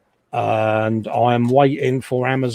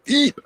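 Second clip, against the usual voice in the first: squeak; 13 semitones higher than usual. Pretend. Squeak after camera move.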